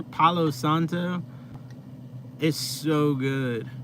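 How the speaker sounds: normal talking voice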